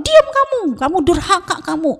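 high-pitched hammed-up telling-off; mock angry scolding. Parent silences protesting child, in high-pitched mock fury. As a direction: cut the child off with acted outrage.

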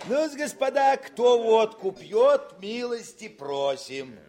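high, loud hearty invitation; hearty call. Acted. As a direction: call the table to drink, the last words drawn out slow. Commander hails officers to vodka. (радушный громкий призыв к водке)

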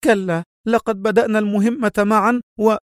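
high, stubborn woman's protest. A narrator's reading. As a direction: refuse high and insistently. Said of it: "هتفت في عناد".

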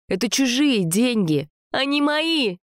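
high, mocking fury; mock him angrily, voice climbing high; furious mimicry. A narrator's reading. Sasha rages at Jacques about money, high furious retort. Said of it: "бесилась Саша".

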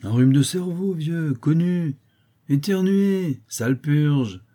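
high, loud, drawn-out mockery; gleeful high-pitched boyish taunt; jeering young rascal. A narrator's reading. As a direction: crow with glee at his agony. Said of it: "en éclatant encore de rire".

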